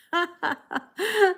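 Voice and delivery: high-pitched real laughing fit. Real, not acted. Streamer cracking up at a viewer's hunch, laughing high. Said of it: "You make me laugh".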